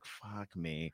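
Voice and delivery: very soft, low aside to himself; defeated mutter. Retold or imitated. Comic watching his first bit fail, muttered despair. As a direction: say it under your breath, sunk. Acting out dejected inner reaction.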